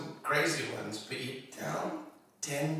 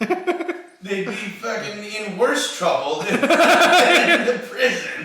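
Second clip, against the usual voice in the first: laughing; 12 semitones higher, 12 dB louder, slower than usual. Real, not acted. Laughing through the punchline.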